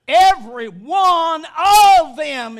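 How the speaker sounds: "Every one of them" punched out loud; emphatic preaching. Real, not acted. Preacher stressing that no sheep is left out, emphatic "Every one of them". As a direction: hit each beat of "Every one of them". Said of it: "is the emphasis".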